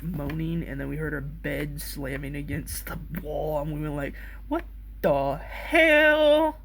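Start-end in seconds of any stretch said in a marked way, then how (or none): 4.5-6.6 high, drawn-out slow disbelief; shocked what the hell. Retold or imitated. Friends reacting to strange noises next door, stunned. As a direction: stretch it out, stunned.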